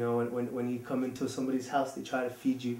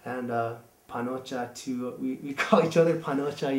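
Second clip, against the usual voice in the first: ordinary talk, nothing marked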